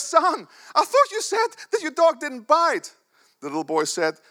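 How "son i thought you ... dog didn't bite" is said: high, loud, slow indignant complaint; outraged bitten man. Retold or imitated.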